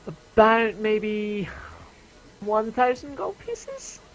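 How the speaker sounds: high, slow, drawn-out price quote; high merchant lady, hedging with long pauses; merchant woman. Acted. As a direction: work out the price slowly in a high shopkeeper voice.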